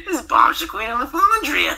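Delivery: very high, loud character voice; Bomb Shaquita LaFondria. Shown as a demonstration. Voice actor introducing requested character to co-hosts, very high loud voice. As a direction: announce yourself in a squealing-high comic persona. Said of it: "if he can do that voice".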